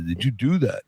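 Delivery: low-voiced imitation of an impressed star; Sly Stallone. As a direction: ask it low, as the admiring actor. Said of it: "When Sly saw it".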